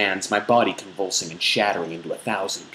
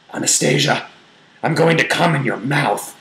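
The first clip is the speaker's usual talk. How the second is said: breathy warning; 6 semitones higher, 4 dB louder than usual. A narrator's reading. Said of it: "his breathy tone is warning".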